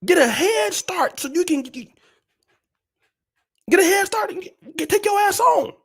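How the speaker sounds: high, insistent send-off; mock-urgent exhortation. Hurrying unhappy subscribers off the channel, high mock-urgent pleading. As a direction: pitch it up and hustle them out.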